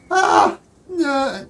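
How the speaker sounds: loud, high-pitched cry; acted wail. Satyr performing distress at the attack, high loud wail.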